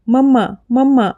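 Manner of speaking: put-on a toddler calling for her mother through tears; lightly voiced child crying mamma; crying out for mum. A narrator's reading. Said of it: "मग ती ओरडली".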